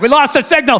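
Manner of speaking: loud frustrated agent shout; feds agent. Retold or imitated. Fed agent yelling at his team in frustration. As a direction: yell it like a fed losing the trail. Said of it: "Then they're like".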